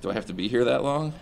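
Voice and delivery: upset, rising objection; distressed protest. Real, not acted. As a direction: protest as if you want out. Patient protests staying in the memory.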